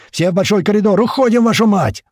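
high, loud sustained shouting; yelled orders. A narrator's reading. Commander herding everyone into the corridor, high loud yell. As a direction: drive the whole squad out at full voice. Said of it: "снова орёт Туман".

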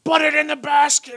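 high, loud exasperated snap; snapping mom. Retold or imitated. Preacher acts a worn-out mom caving to her child, loud snap. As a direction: give in with a furious mock snap. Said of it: "mom's just about to have this conniption just snaps".

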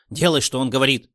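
loud snapped command; angry order. A narrator's reading. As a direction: order him sharply. Boss orders his henchman.

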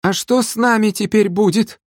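very high, anxious bleating; timid bleat. A narrator's reading. Captured river bandit asking about his fate, timid high bleat. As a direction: bleat the question like a frightened captive. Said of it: "вдруг проблеял за спиной один из ушкуйников".

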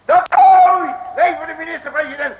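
high, loud mocking cheer; loud outburst. Detective interrupting the minister's speech, loud mocking cheer. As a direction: burst in with a loud high shout.

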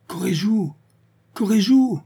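put-on old woman crying out the sailor's name from her bed; desperate calling. A narrator's reading. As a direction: call after the sailor in despair. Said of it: "appelait désespérément".

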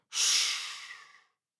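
a sharp whispered shush; whispered shh to silence someone; hushing whisper. A narrator's reading. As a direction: shush him in a breathy hiss. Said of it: "прошептала она".

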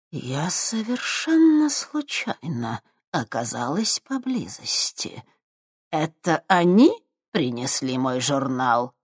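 put-on slow, cold old woman voice; icy tone. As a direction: ask it coldly, like a sinister crone. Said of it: "ледяным тоном осведомилась старуха".

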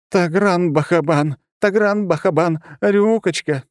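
high, loud, slow panicked pleading; frantic babbling. A narrator's reading. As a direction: plead in a frightened rush. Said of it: "тут же зачастила бледная".

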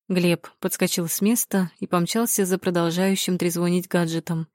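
ordinary delivery

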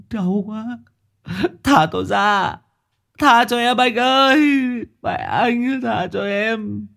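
very high, crying cries of pain; wailing plea. A narrator's reading. Captive crying out in pain to the jailer. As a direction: beg to be let out. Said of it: "kèm theo tiếng kêu la".

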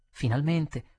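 ordinary delivery

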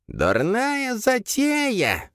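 very slow, high grating creature. A narrator's reading. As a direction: grind it out scornfully. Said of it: "заскрежетала мать".

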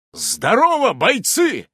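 loud, high rallying call; seasoned general's shout. A narrator's reading. Player greets his fighters, shouting like a general. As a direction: hail the troops like an old general. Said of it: "тоном бывалого генерала громко кричу я".

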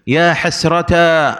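"حسرتا" slowly ending in a long aa; ya turned alif. Shown as a demonstration. Teacher showing the fourth form, "حسرتا" slow with long aa. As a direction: stretch the final aa of "حسرتا". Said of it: "وقلب الياء ألفا".

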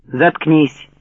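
curt snap to shut him up; sharp shout. Acted. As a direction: bark it sharply, cut him off. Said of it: "резким окриком оборвал". (резкий окрик)

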